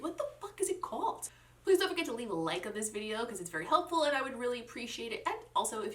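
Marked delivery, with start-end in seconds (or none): 0.0-1.3 high-pitched frustrated aside; exasperated. Real, not acted. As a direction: throw up your hands, high. Exasperated aside about collection name.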